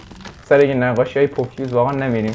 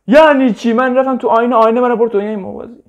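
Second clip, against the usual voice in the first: high, loud astonished questions; shocked outburst; 7 semitones higher, 5 dB louder than usual. Real, not acted. Gamer stunned the mirror took him elsewhere, shouting. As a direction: blurt out your disbelief.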